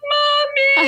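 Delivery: put-on high, loud child's wail for mommy; little kid calling. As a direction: call out like a small child being carried off. Child calls out over his shoulder.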